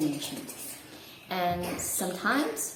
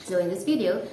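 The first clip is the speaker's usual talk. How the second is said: ordinary talk, nothing marked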